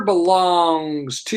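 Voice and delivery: long, drumroll-style build to a name; suspenseful drawn-out announcement. Real, not acted. Host announcing whose question is next, drawn-out suspense. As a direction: stretch the words out, holding the last.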